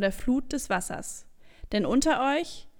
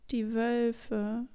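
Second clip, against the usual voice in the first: leise, den Tränen nahe; Stimme versagt; 3 dB quieter than usual. Acted. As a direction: die Stimme bricht beim Wort Wölfe. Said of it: "dann versagt ihm die Stimme". (mit versagender Stimme)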